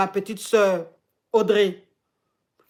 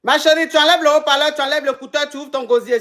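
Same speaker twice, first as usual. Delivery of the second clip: loud calling out; 6 semitones higher, 6 dB louder, faster than usual. Real, not acted. Urging quiet caller to speak up.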